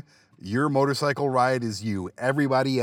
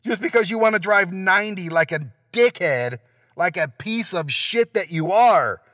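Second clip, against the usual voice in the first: normal talking voice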